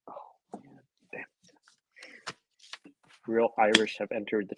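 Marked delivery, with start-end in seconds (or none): 0.1-2.3 awed whisper. Real, not acted. Awed at a big pour.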